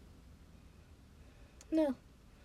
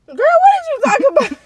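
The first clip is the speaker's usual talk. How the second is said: exasperated demand; 14 semitones higher, 5 dB louder than usual. Real, not acted. Girlfriend demanding what's being wrapped up, exasperated. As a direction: raise your voice sharply to demand an answer.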